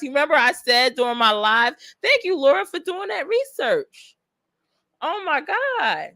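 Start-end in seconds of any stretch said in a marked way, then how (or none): 2.0-6.1 grateful and close to tears; tearful thanks. Real, not acted. Streamer thanking Laura for research, tearfully. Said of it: "I'm about to cry".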